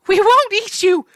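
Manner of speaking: high, loud pleading horse wail. A narrator's reading. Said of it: "the horses wailed".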